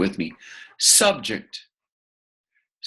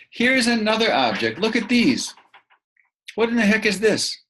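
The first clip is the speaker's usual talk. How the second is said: much higher, fast, playful surprise; hammed-up wonder for young learners; mock amazement; 10 semitones higher than usual. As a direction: gasp at it like a curious kid. Teacher plays surprised with objects.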